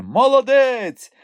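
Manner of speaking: high, drawn-out crowd cheer; cheering shout. A narrator's reading. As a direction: call it out in slow, high admiration. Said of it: "чулося звідусіль". (захоплені вигуки натовпу)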